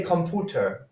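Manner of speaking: "computer" as com-POO-ter, without the y-glide; wrong German-style U. Shown as a demonstration. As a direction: say "computer" with a plain "oo". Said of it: "but you don't say".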